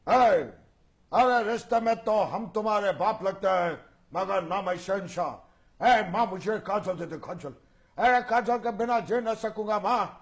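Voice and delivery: bold film-hero impersonation; Amitabh Bachchan. Acted. Hero pleads with mother for Kajal, bold impersonation. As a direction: declaim boldly with punched openings and stretched phrases. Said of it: "Amitabh Bachchan voice, bold voice".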